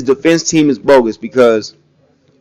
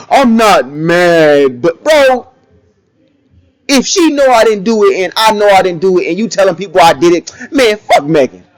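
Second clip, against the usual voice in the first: mock rapper reply; 4 semitones higher than usual. Commentator voicing the rapper answering an interviewer, defiant mock reply. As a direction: play him brushing off the question, then snapping.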